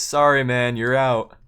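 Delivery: smiling dismissal. Retold or imitated. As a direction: imitate a host gleefully calling someone out. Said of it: "kinda has a smile on him".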